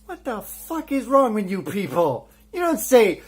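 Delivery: ordinary delivery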